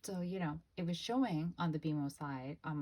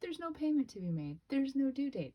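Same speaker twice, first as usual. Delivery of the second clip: high, sing-song voice of a credit card; a credit card charming its owner, high and sweet; sweet-talking credit card; 10 semitones higher, 4 dB louder than usual. Pretend. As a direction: coo it like a flattering card. Voicing the credit card's sweet talk.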